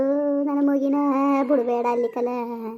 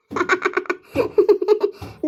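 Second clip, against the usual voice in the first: laughter; 3 semitones higher than usual. Acted. Laugh after being called quarrelsome.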